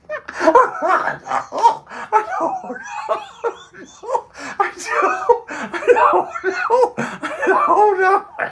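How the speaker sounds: high, loud laughter breaking up the words; laughing. Real, not acted. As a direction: fall apart laughing. Cracking up at own joke.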